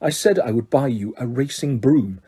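low, soft, cold aristocrat; low and quiet cold menace; cold stern father. Acted.

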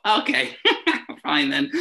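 high, loud, laughing through the words; amused resignation with real laughter. Real, not acted. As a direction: laugh while giving in. Laughing at own retold reaction.

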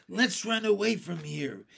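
ordinary delivery

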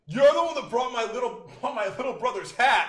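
high, loud accusing voice; retold confrontational shout. Stranger confronting him at the bar over a hat, loud mock accusation. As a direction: call him out loudly, imitating a stranger.

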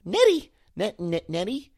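very high, calling cartoon voice; tentative high impression, repeated call; Maud Flanders. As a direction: squeal "Nettie" way up high. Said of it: "none other than Maud Flanders".

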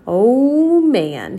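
ordinary delivery, nothing marked

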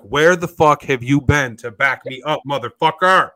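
loud, slow, furious demand; angry full-voiced shout; yelling at cops. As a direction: yell it out slowly, hitting every word. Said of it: "I start yelling at the cops".

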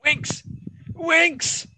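high-pitched commentator cry; mimicked commentary. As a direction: call out the name in a very high voice.

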